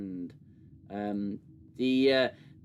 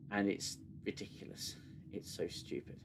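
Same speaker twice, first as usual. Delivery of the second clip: low, hushed, defeated grumble; quiet exasperation; 5 semitones lower, 12 dB quieter than usual. Real, not acted. Reviewer gives up on a show they hate, quiet exasperation. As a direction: drop very soft and low, fed up.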